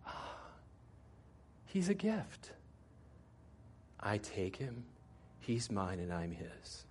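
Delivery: a soft, awed "ah" of understanding; quiet realization. Retold or imitated.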